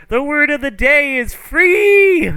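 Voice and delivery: high, loud, drawn-out announcement; manic showman's shout. Acted. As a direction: yell it out like a crazed kids'-show host. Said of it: "He's like".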